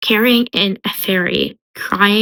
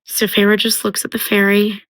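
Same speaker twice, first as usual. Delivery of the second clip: ordinary delivery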